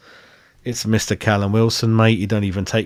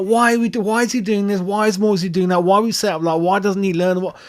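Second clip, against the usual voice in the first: higher, exasperated mock dad questions; imitating his frustrated dad; 8 semitones higher than usual. Son retelling his dad's rant, voice pitched up in mock exasperation. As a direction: pile on the baffled questions like the old man. Retold dad ranting at the match.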